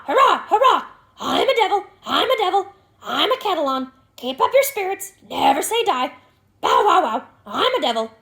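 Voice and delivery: high, loud screeching bird; excited raven. A narrator's reading. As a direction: squawk with wild glee. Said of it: "suddenly struck with an idea and very much excited".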